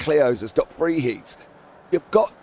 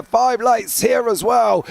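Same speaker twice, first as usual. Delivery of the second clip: excited race start; 8 semitones higher, 5 dB louder than usual. Real, not acted.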